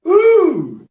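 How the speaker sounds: thrilled high-pitched ooh of recognition; gleeful high ooh; excited ooh. Real, not acted. Delighted at Starbucks meeting memory.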